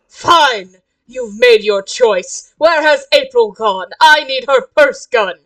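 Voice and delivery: loud, dramatic angry declaration; fed-up threat. Acted. Omen wants April's gun, fed-up threat. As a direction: lose patience and announce it loudly. Character threatens stubborn cosplayer.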